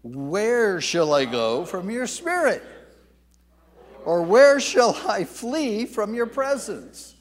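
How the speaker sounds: impassioned psalm reading; read with feeling. Shown as a demonstration. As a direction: read it aloud with real feeling, building. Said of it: "Let's read this aloud with feeling".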